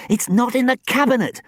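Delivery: high, loud, sharp demand; sharp high-pitched interrogation; sharp accusation. A narrator's reading. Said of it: "Sam hissed sharply".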